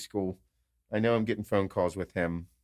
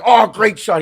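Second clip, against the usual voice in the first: loud, high exclamation of praise; hammed-up cheer for a good shot; mock cheering; 11 semitones higher, 13 dB louder, slower than usual. Players praise a shot, mock cheering. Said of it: "And then we're like".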